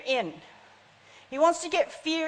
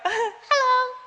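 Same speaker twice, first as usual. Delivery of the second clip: high, small squeaky hello; tiny little man; 11 semitones higher than usual. Retold or imitated. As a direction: shrink the voice way up high. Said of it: "this tiny little man going".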